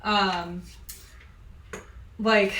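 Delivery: usual speaking voice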